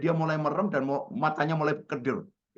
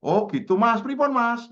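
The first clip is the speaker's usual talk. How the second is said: imitated friendly reply; 10 semitones higher, 5 dB louder, slower than usual. Acted reply to old friend.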